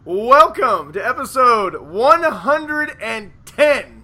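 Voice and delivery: loud, slow show-host intro; announcer. Acted. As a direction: boom it like a host opening.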